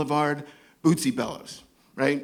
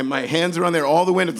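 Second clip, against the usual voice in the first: ordinary talk, nothing marked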